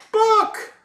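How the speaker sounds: high squealed greeting; excited call. Real, not acted. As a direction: squeal the name very high and loud. Calling out a viewer's name.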